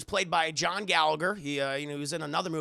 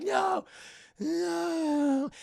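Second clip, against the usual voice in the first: higher, frightened little pleas; pleading no; 10 semitones higher than usual. Retold or imitated. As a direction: beg weakly as she goes under. Michelle drugged against her will.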